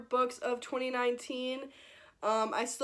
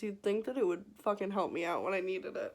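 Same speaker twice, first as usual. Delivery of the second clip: usual speaking voice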